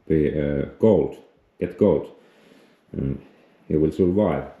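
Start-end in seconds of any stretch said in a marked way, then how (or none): none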